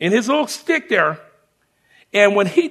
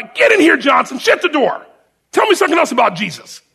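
much higher and louder gruff summons, quickening at the end; a drill sergeant who secretly wants to hear more; barking sergeant; 7 semitones higher, 6 dB louder than usual. Retold or imitated. Sergeant calls recruit back in.

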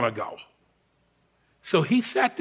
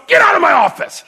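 much louder, higher order to leave; angry shout; 11 semitones higher, 8 dB louder than usual. Retold or imitated. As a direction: throw him out with your voice. Sergeant throws recruit out again.

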